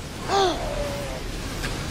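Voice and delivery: big theatrical gasp; dramatic gasp. Pretend. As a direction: gasp as if unsure what comes next. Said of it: "That's my gasp of "I don't know".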